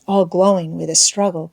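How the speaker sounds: usual speaking voice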